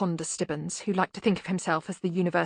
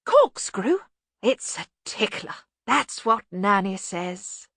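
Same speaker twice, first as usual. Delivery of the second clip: dotty bursar; 2 semitones higher, slower than usual. Acted. Bursar replying with nonsense, dotty.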